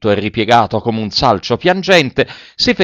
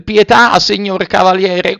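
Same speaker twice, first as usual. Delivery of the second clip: very high puppet begging; high, imploring little voice; pleading; 8 semitones higher than usual. A narrator's reading. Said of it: "con voce supplichevole".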